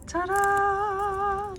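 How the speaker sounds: high, sung-out fanfare; drawn-out triumphant reveal. Real, not acted. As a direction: present it with a long, high flourish. Reveals the scored piece.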